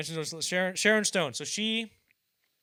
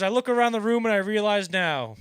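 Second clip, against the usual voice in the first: high, loud roast-comic proclamation; imitating an insult comic on stage; Don Rickles; 8 semitones higher, 14 dB louder than usual. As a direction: announce it to a whole room you are mocking. Retelling Rickles roasting the whole room.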